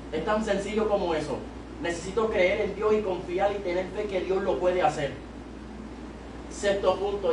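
normal talking voice

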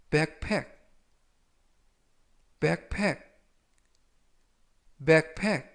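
backpack, said slowly three times for students to copy; backpack을 천천히 세 번 또박또박; slow repeat-after-me. Shown as a demonstration. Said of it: "천천히 세 번씩 읽어드리고요". (따라 읽도록 천천히 세 번)

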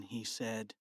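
ordinary delivery

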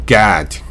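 God with a long open 'ah'; American pronunciation. Shown as a demonstration. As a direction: say God as 'gaahd'. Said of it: "あに近いですよね".

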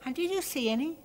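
put-on high, eager little girl voice; curious girl. Girl asking the bug hunter, curious. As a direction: ask with real interest. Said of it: "inquired Arabelle".

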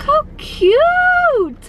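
high, slow, gushing squeal; excited squeal. Real, not acted. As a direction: squeal with delight at something cute. Gushing over her pink drink.

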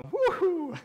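loud, high, drawn-out woohoo; excited cheer. Real, not acted. Pastor whoops to the congregation about running long, excited. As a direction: let out a big happy whoop.

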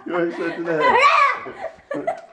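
high giggly teasing; laughing gloat. Real, not acted. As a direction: tease through laughter. Gloating after scare, laughing.

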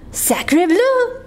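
put-on high French-accented exclamation; duck's French cry. French duck wants new nest, high cry. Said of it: "cried the duck".

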